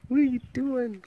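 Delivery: high, sing-song what are you doing?. Real, not acted. Owner asking her guinea pig in baby talk, high sing-song.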